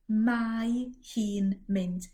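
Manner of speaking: mae hi'n mynd, spelled out very slowly. Shown as a demonstration. Teacher slowing a phrase for learners. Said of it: "She's going".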